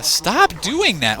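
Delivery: slow, high exasperated whine. Real, not acted. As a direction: drag it out in frustration. Exasperated at the cameraman.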